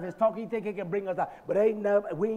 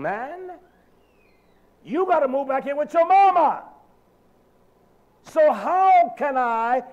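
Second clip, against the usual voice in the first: full-force preaching; 7 semitones higher, 6 dB louder than usual. Real, not acted. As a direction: hammer each question at the crowd.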